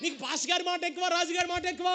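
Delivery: mocking king raising his voice; king's taunting shout. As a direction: shout the challenge up high like an angry king.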